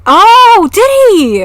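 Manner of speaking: high, loud, drawn-out surprised question; real, big "oh really" reaction; genuine surprise. Real, not acted. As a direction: swoop up in delighted disbelief at news. Reacting to news from chat.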